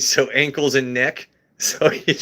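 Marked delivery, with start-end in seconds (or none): 1.3-2.1 real laugh breaking in, higher pitch. Real, not acted. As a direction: say it through a laugh. Laughs at the dog's name joke.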